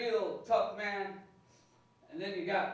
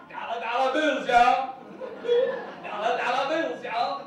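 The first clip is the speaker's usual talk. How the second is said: loud, high giddy chant; 7 semitones higher, 15 dB louder, slower than usual. Acted. Dentists seeing his teeth, giddy and shouting. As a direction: whoop it out like you've struck it rich. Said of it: "they just get all giddy".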